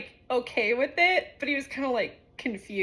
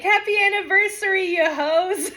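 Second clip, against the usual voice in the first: high, loud celebratory call; mock-cheer, called out to friends; gleeful congratulations; 8 semitones higher, 6 dB louder than usual. Friend replays the anniversary message she sent, gleefully.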